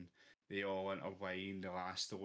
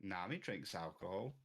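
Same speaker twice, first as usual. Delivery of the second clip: ordinary talk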